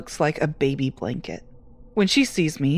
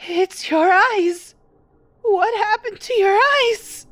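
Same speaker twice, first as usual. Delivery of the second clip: put-on very high, slow, horrified old woman; shaking frightened mother; trembling terror; 4 dB louder, slower than usual. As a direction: gasp it out at the sight of the eyes. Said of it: "She trembles and gasps".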